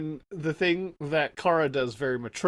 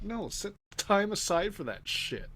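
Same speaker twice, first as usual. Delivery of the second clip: mock exasperated retort; 5 semitones higher, 4 dB quieter than usual. Imagined retort to couple.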